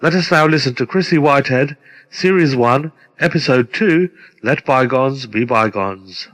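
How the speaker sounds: usual speaking voice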